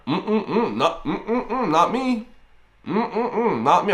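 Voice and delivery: high, sing-song refusal; playful high-pitched "not me" protest; mock denial. Playful denial of having moved anywhere.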